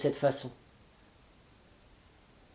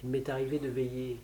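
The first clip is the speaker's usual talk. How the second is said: same words, now soft and low; hushed; 3 semitones lower, 11 dB quieter than usual. A narrator's reading. Narrator recalls his night under the tree, hushed and low. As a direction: hold it down like a secret.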